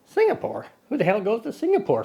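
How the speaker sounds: Singapore? jumping high, incredulous; mock disbelief. Host mocking his own trip choice, high incredulous. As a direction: sound baffled by the idea.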